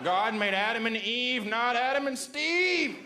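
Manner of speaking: high, slow sing-song chant; protest slogan. Acted. Mocking a protester's slogan in a slow sing-song. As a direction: chant it like a picket rhyme.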